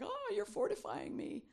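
high delighted exclaim; delighted gasp. Retold or imitated. Preacher voices her own grateful reaction, high and delighted. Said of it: "It's like".

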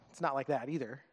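normal talking voice

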